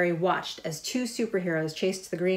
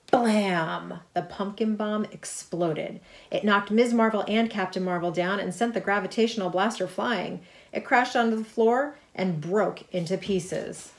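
ordinary delivery

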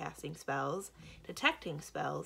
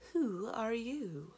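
very slow, higher, whispery question; artificially pleasant; 5 semitones higher, much slower than usual. A narrator's reading. Giantess questions strangers at the gate, artificially pleasant. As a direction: draw it out with eerie politeness. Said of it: "still in an artificially pleasant tone".

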